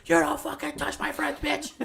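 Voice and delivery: high, loud furious yell; angry yell. Retold or imitated. Friend yelling at the girl who punched him, furious shout. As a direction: shout it like defending your friend. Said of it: "And he's like".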